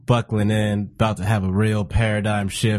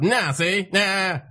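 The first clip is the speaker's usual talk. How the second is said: Chappelle impression; 9 semitones higher, much slower than usual. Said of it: "It's old Chappelle".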